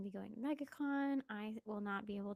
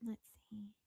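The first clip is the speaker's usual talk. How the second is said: whispering; 9 dB quieter than usual. Real, not acted. Whispering near the dog.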